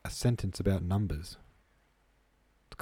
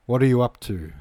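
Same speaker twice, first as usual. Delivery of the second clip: louder, higher sergeant major's challenge; authoritative narrated rebuke, raised in pitch and level; 4 semitones higher, 7 dB louder than usual. A narrator's reading. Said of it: "suddenly came the authoritative voice of".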